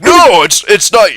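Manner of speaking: high, loud flustered denial; panicked protest. Acted. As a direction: protest as they grab you. NPC protesting arrest.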